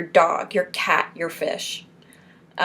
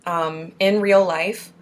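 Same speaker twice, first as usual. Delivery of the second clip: usual speaking voice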